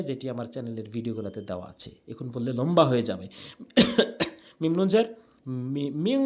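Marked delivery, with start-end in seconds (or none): 5.9-6.2 মিং with a long nasal ng; ghunna. Shown as a demonstration. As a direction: hum the end of মিং through the nose. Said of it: "গুন্নাহ করে পড়বেন".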